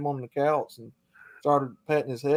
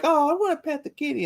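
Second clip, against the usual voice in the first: higher, sing-song imitation of a doting owner; mock girly voice; 13 semitones higher than usual. Mocking a woman who calls her puma her pet kitty, cooing.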